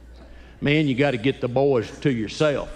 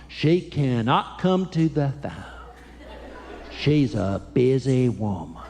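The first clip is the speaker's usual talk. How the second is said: proud little man; slower than usual. Retold or imitated. Young son on the phone claiming he's in charge, slow and proud. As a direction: puff up like the man of the house.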